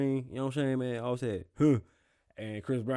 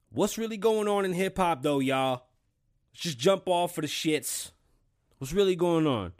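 energetic opener; 7 semitones higher, 4 dB louder than usual. Real, not acted. Energetic show segment opener.

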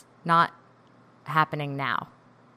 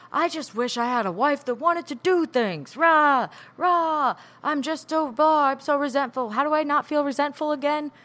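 higher, whining self-mockery; whiny complaining; 8 semitones higher than usual. Speaker mimicking how she complained to her therapist, whiny and higher. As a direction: replay your own grumbling at therapy, overdone. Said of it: "started out with me saying".

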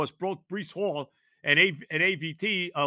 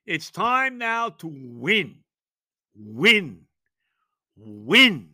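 demanding; 6 dB louder, much slower than usual. Real, not acted. Host demanding wins from the Jets, loud pounding. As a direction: pound out every win.

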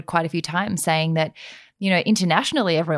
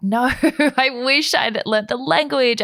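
mock regret; 7 semitones higher, 3 dB louder, slower than usual.

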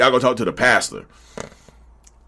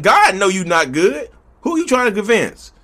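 usual speaking voice

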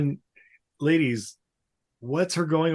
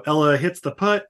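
ordinary talk

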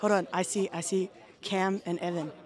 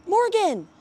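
calling out; 9 semitones higher, 7 dB louder than usual. Real, not acted. Calling to a friend.

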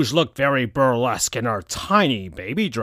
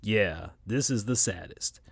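Knuckles, sad; 3 semitones lower, 7 dB quieter than usual. A narrator's reading. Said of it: "said Knuckles".

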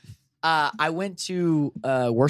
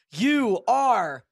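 mock Maury verdict; 12 semitones higher, 5 dB louder, much slower than usual.

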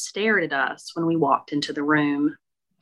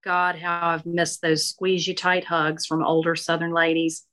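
usual speaking voice

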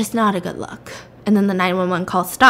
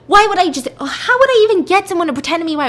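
high, loud acted indignation; incredulous protest; 8 semitones higher, 5 dB louder, faster than usual. Husband denying he faked his wife, high indignant protest. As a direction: protest as if it's absurd, voice pitched up.

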